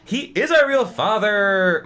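pressing the point, leaning on our; insisting. Acted. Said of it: "Margaret insisted".

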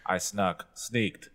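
ordinary talk, nothing marked

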